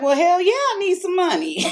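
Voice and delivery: high, loud, eager acceptance; eager hell yeah. Retold or imitated. To the husband offering money, a high loud eager yes.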